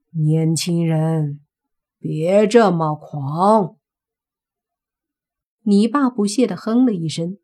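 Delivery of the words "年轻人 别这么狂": slow warning. Acted. Father warns cocky youth.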